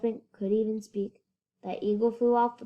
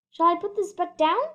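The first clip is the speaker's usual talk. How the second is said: alarmed; 8 semitones higher, 5 dB louder than usual. A narrator's reading. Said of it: "Horton thought with alarm".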